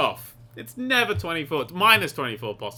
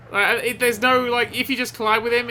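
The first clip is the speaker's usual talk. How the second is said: ordinary delivery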